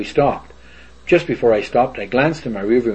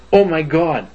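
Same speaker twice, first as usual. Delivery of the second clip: mild mock scream, pitched higher; retold scream; 6 semitones higher than usual. Man retelling his wife's fright at the alien, mild scream. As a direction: imitate a startled scream without full volume. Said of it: "my wife screamed".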